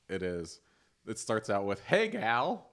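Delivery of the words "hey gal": mock friendly greeting. Reading spam message aloud.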